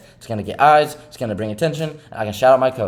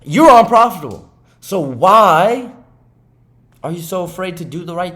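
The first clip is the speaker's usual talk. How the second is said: high, loud scolding burst; self-scolding shout; 5 semitones higher, 7 dB louder than usual. Acted. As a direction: yell the hard truth at yourself. Scolding himself as a trader.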